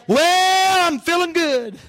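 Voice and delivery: high, drawn-out whoop easing down; exultant outburst. Real, not acted. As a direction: let out a jubilant preacher's holler. Exultant outburst at sermon's peak.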